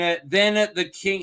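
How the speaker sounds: ordinary talk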